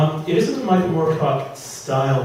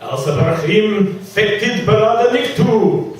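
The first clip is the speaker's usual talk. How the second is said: slow, higher, louder mock incantation; wizard intoning; 8 semitones higher, 8 dB louder, slower than usual. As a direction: intone the spell like a hammy wizard. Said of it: "the wizard intoned".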